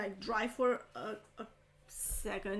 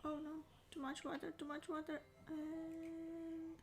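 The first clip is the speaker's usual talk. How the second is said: holding breath; 6 semitones higher, 10 dB quieter, slower than usual. Real, not acted. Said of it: "I'm kinda holding my breath again".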